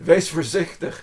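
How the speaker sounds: "Wees voorzichtig" half laughing, loud and high; laughing warning. Shown as a demonstration. Warning a cyclist about to hit a tram, laughing. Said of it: "that means be careful".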